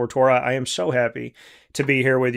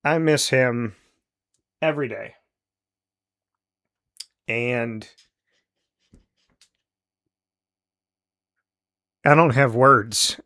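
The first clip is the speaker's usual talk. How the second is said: real grief. Real, not acted. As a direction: let the words catch as you miss him. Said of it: "without getting emotional".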